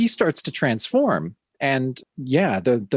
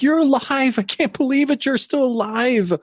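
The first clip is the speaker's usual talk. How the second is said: much higher, hysterical mock shriek; freaking out; 12 semitones higher than usual. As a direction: play the overwrought heroine losing it. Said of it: "Elaine just freaks the fuck out".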